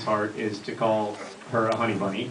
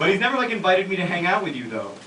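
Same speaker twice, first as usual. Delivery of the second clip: Angela's higher voice; 6 semitones higher than usual. Acted. Angela voiced in read scene.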